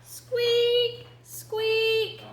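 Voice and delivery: very high, drawn-out squeaks; tiny high mouse voice; nervous mouse. Acted. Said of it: "said Mouse nervously".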